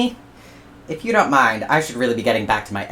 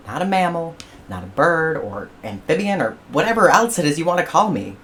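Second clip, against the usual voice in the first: rising louder, higher lecture; platypus rant; 5 semitones higher, 5 dB louder than usual. Acted. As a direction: tell him off point by point.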